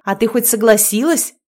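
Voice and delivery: doubting question with a rising end; suspicious. A narrator's reading. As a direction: check on her, mildly suspicious. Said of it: "подозрительно спросила Наташка". (с лёгким подозрением)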